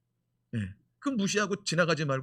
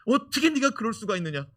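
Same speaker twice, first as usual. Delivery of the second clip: brothers yelling; 4 semitones higher, 7 dB louder than usual. Retold or imitated. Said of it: "분명히 때리면서 그랬을 거예요".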